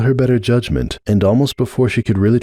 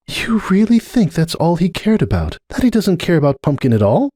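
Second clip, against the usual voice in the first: higher, stunned question; Starlight, stunned; 10 semitones higher than usual. Acted. Starlight stunned by Cupcake's view, higher disbelieving voice. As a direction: ask in disbelief.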